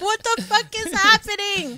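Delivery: high loud panicked outburst; freaked out. Retold or imitated. Daughter learning mom listens, panicked shriek. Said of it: "I was like".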